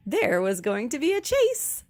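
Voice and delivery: ordinary delivery, nothing marked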